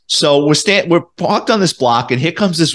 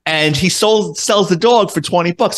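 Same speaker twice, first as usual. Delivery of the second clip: normal talking voice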